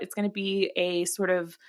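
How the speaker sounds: normal talking voice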